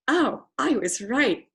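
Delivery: smug thought. Retold or imitated. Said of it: "my head said".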